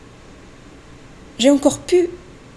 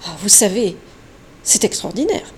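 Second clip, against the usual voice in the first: ordinary talk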